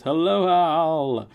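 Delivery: drawn-out greeting. Pretend. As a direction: draw the greeting out like a grand welcome. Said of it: "the way it was meant to be said".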